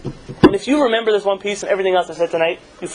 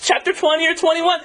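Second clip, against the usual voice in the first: put-on high, fussy girlish worrying; a fretting schoolgirl, pitched high; mock seminary girl; 7 semitones higher than usual. Teasing seminary girls on the bus in a high fretful voice. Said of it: "And they're thinking, like".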